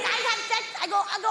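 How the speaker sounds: ordinary delivery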